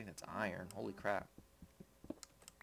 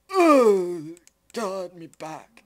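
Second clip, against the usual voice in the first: high, loud groan then slow dying words; dramatic video game death cry; game death sound; 10 semitones higher, 18 dB louder, much slower than usual. Pretend. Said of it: "the death sounds would be".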